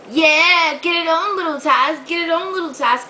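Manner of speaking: egging the little dog on, sing-song; cooing at the dog. Real, not acted. To her humping puppy, playfully cooing.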